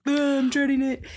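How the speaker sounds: high, groaning dread; mock dread. Recalling basketball camp to her husband, whiny dread. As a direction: whine it like a kid dreading drills. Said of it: "You're just like".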